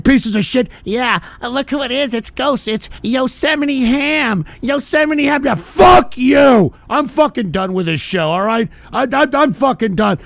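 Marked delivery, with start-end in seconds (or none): 5.5-6.7 slow angry shout. Pretend. Host yelling at prank callers, slow furious shout. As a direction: drag out the curse, loud and slow.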